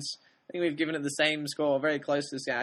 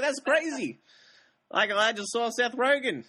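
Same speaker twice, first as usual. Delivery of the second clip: higher, amazed exclamation; retold amazement; 5 semitones higher than usual. Telling the co-host about meeting a film star, amazed. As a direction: blurt it out, amazed. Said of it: "I was like".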